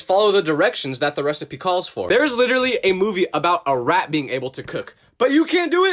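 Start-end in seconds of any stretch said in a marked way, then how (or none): 5.2-5.9 high, incredulous jab; exasperated. Acted. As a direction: pitch it up in disbelief. Ranting at people who can't cook.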